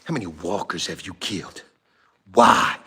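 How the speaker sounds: low, quiet interrogation; Rick Grimes. Retold or imitated. Painter confronts his helper Gene, low and seething. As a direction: drop very low and soft, seething underneath. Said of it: "I hit the frickin' Rick Grimes on him".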